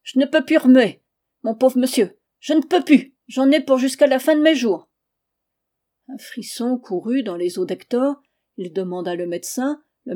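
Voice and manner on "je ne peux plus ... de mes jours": loud, high groaning lament; moaning. Acted. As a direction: whine it out loud like an old woman in pain. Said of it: "se mit à geindre".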